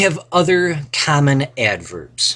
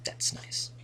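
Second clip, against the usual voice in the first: soft unvoiced whisper to herself; whispered aside; 16 dB quieter than usual. Real, not acted. Teacher whispers a wry aside after the troll sentence. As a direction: breathe it out as a private aside.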